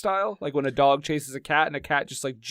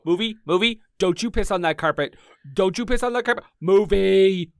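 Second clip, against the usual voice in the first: raised-pitch mock pet-owner warning; scolding a dog; 3 semitones higher, 3 dB louder than usual. Owner stopping a dog on the carpet, mock scolding. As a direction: warn the naughty pet, getting higher and louder.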